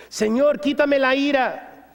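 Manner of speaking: high, slow whiny plea; pleading to God. Acted. Worshipper begging God to take the wrath, whiny plea. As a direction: drag out the begging. Pleading prayer vs scripture reply.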